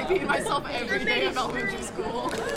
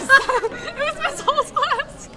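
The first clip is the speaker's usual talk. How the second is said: high-pitched, slow, breaking into laughter; real giggling fit mid-sentence; cracking up; 14 semitones higher, 4 dB louder, much slower than usual. Real, not acted. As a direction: laugh helplessly through your own embarrassing story. Cracking up telling embarrassing story.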